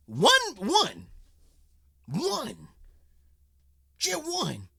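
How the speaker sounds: high, incredulous repeat of one; mock disbelief. Reactor mocks the one-billion payout, mock disbelief. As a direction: scoff at just one billion.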